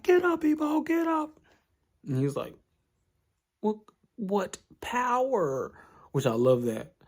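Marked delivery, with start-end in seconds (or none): 0.0-1.4 high put-on voice urging him to get up; ringside yell. Takamura yelling to downed Ippo. 3.6-5.7 high, slow, dazed disbelief; stunned awe. Retold or imitated.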